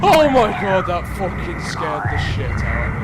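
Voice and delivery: sudden frightened yelp, then swearing; jolted by a jump scare; startled. Real, not acted. Jump scare in horror game.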